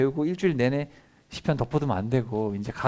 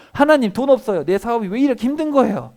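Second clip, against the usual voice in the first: much higher, louder whining plea; a struggling businessman crying out; mock wailing complaint; 10 semitones higher, 8 dB louder than usual. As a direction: whine to God about money. Complaining to God about money.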